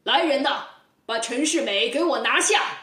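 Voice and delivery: loud shouted order. Acted. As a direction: roar for the guards to seize him. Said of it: "接着大喝一声". (包公大喝命人拿下陈世美)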